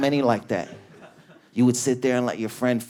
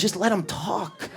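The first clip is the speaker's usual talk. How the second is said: high, hushed sympathetic voice; imitated coworker; 7 semitones higher than usual. Bakery worker to an annoyed colleague, high mock-gentle plea. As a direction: plead for him in a small, raised voice. Other worker pleads for him.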